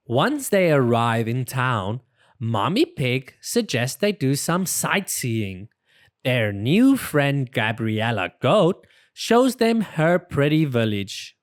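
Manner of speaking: usual speaking voice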